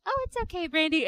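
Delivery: high, soft cooing reassurance; gently sing-song mock sympathy; mock-sweet reassurance. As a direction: imitate understanding friends, sweetly high and soft. Imitating sweetly understanding co-hosts.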